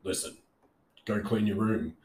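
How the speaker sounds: a hint of a stern father, tone falling at the end; stern dad. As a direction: lightly imitate dad giving an order. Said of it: "speaks to you exactly like that".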